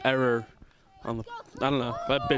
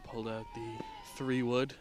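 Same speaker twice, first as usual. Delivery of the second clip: golf announcer; 5 dB quieter than usual. Acted. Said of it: "I gotta talk like a golf announcer".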